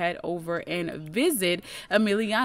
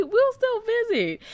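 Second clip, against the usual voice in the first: high drawn-out reassurance; 3 dB louder than usual. Acted. Voicing Javi reassuring friend.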